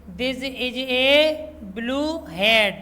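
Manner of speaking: very slow, word-by-word reading. Shown as a demonstration. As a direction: read it out slowly for the children. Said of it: "reads sentence slowly to class". (बच्चों को धीरे-धीरे पढ़कर सुनाना)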